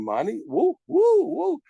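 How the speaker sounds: woo shot up high and loud; gleeful whoop. Acted. Teacher whooping over full damages to the class, excited. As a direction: cheer it like money just landed.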